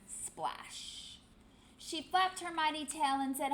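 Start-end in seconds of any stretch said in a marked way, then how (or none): 0.1-1.2 breathy whispered splash. A narrator's reading. Splash sound effect.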